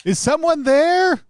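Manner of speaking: high, drawn-out call; loud, high-pitched holler for a stranger; yelling. Pretend. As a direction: shout it out slowly into the distance. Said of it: "after I yell".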